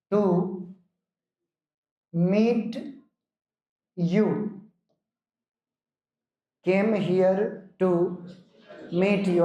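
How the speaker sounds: came here to meet you very slow; teacher dictating slowly to students; slow dictation. Shown as a demonstration. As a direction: stretch each word for the copying class. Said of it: "एक और लिखो".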